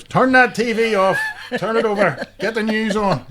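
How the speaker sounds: a parent scolding the family over rude TV, indignant. Retold or imitated. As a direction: play the outraged mammy. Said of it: "now, if somebody said knickers".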